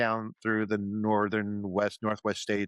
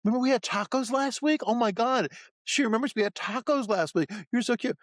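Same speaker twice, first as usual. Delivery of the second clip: much higher, gushing parent voice; thrilled parent. Retold or imitated. Sketch parent gushes over the baby in a much higher voice. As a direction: gush over the talking baby.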